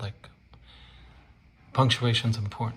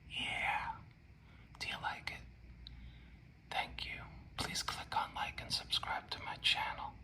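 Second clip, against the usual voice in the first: whispered outro; 13 dB quieter than usual. Real, not acted. Whispered sign-off to viewers.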